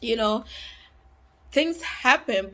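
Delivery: normal talking voice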